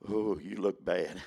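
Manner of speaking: low, slow, soft mocking self-talk; a lightly voiced gloomy inner critic; his own doubting voice. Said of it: "That's [name] talking".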